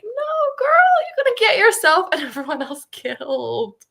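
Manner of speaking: high, loud cry of alarm, laughing at the end; a dramatic shout of protest at a reckless character; mock horrified shout. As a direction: yell at her to stop, half laughing. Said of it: "but I was like".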